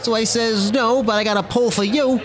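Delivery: New Jersey wiseguy telling a cocky tale; animated tough-guy storyteller with a Jersey accent; Joe Pesci. Acted. Wiseguy recounting a run-in with a cop, Pesci-style bravado. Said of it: "You do that accent so well".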